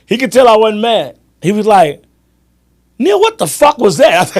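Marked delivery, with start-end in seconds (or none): 3.0-4.3 high-pitched incredulous friend; retold outrage. Stunned friend turning to him after the play, mock outraged. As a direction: imitate a fan demanding an explanation.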